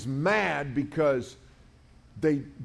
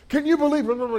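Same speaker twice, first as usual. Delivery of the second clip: much higher and louder, an indignant burst; ranting outrage; 12 semitones higher, 7 dB louder, faster than usual. Retold or imitated. Angry sixty-year-old customer ranting to the speaker about his sandwich, loud and indignant. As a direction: launch into an outraged complaint. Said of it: "and he starts ranting".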